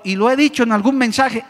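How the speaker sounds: ordinary talk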